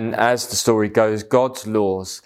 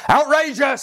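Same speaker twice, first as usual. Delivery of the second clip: high shrieking outburst, much higher; outraged patient screaming at the doctor; screamed outrage; 3 dB louder than usual. A narrator's reading. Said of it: "screamed the first patient".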